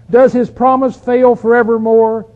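loud, slow rhetorical question; ringing, drawn-out Bible reading; scripture reading. Acted. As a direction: proclaim the psalmist's question to the congregation. Reads psalm's questions aloud.

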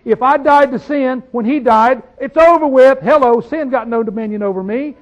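rising triumphant declaration; triumphant preaching. Real, not acted. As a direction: declare it's over, triumphant.

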